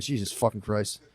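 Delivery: quiet exasperated mutter. Retold or imitated. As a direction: mutter it under your breath. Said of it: "I was like".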